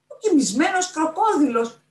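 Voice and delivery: very high, loud echoing cry; astonished shout. A narrator's reading. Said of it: "φώναξε ο Περπερούα".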